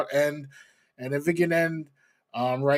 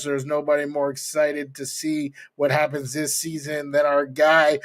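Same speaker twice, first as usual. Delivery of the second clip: ordinary delivery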